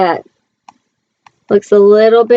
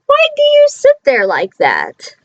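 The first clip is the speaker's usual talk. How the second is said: much higher, sly cartoon cat; Cat in the Hat voice; 14 semitones higher than usual. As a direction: play the cheeky cat teasing the kids. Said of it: "And he said to us".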